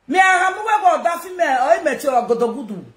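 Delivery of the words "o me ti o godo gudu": normal talking voice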